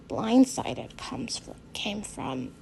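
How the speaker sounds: breathy hushed whisper. Acted. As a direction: let the words fade to a whisper. Whispered line end.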